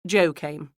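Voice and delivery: usual speaking voice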